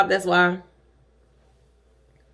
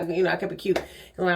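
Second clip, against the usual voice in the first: normal talking voice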